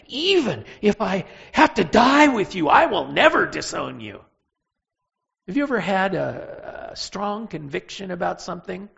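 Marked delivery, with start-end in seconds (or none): 0.1-4.2 loud, fervent declaration of loyalty; emphatic vow. Acted. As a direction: swear it with full conviction. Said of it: "oh, emphatically".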